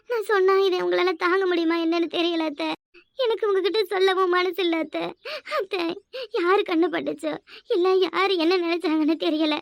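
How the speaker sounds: put-on crying, words broken by sobs; tearful lament. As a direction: sob as if too grief-stricken to tell. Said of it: "எதுக்கு அழுவுற?". (தேம்பி அழும் குரல்)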